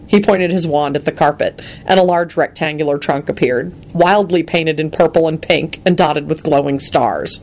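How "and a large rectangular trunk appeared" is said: ordinary delivery, nothing marked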